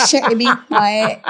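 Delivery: quick Yoruba question in a put-on foreigner's accent; mock foreign-accented Yoruba. Shown as a demonstration. Showing how odd accentless Yoruba sounds, mock foreign accent. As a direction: imitate a learner's wrong Yoruba accent. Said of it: "How does it sound?".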